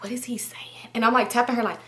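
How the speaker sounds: hushed whisper so the caller can't hear. Retold or imitated. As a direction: whisper urgently to your friend. Whispering to friend during call.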